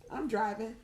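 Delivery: usual speaking voice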